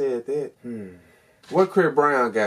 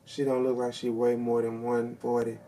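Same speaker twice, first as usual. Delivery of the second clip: ordinary talk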